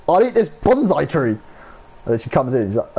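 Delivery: ordinary talk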